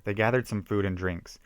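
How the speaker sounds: ordinary delivery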